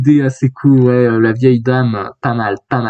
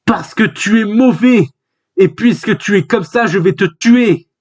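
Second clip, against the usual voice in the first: higher-pitched hero's threat, slowing at the end; acted defiant threat; 6 semitones higher than usual. Hero answering an enemy he will kill, acted defiance. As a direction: lift the pitch and declare it defiantly.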